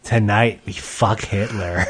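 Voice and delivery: low, slow announcer voice; mock promo announcer. Comic promoting tonight's show, low slow mock announcer. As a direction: announce it slowly, dropped deep for effect.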